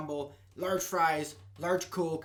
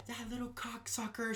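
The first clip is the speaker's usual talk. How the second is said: retold cursing; 6 semitones higher, 8 dB quieter, slower than usual. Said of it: "it's all cuss words".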